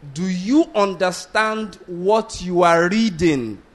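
"reading" set out very slowly and deliberately; slow the whole question down, landing clearly on "reading"; emphatic question. Retold or imitated. Philip questioning the Ethiopian eunuch, slow and emphatic. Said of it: "emphasize there is the word reading".

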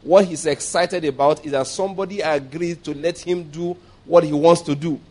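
usual speaking voice

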